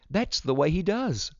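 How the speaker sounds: put-on high-pitched, eager girl; exclaimed. A narrator's reading. Vida explains the dog's signal to Daddy, high exclamation. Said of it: "Vida exclaimed".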